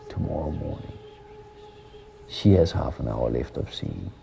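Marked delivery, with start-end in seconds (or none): none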